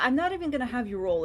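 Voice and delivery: ordinary delivery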